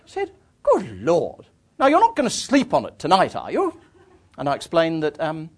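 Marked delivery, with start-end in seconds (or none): 0.6-3.8 higher, emphatic disbelief; imitated countrywoman's scandalised question; English countrywoman. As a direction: play an incredulous country lady taking charge. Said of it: "archetypal English countrywoman".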